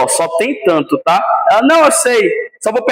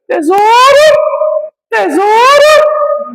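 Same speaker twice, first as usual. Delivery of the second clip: drawn-out, much higher "tesouro"; doting mother; 12 semitones higher, 4 dB louder, much slower than usual. Retold or imitated. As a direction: coo it like a protective mom. Voicing the protective mother. (mãe mimando, bem aguda e arrastada)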